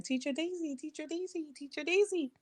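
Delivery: put-on high, soft childish calling of the name; kids calling teacher. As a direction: echo the little voices calling Teacher Daisy. Children calling in her dream.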